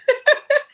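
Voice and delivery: very high, loud burst of laughter. Real, not acted. Owning up to being loud, big high laugh.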